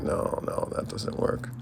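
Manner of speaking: unvoiced whisper to himself; a quiet unvoiced aside while judging the table. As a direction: whisper it, disappointed. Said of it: "He's like".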